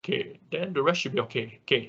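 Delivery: normal talking voice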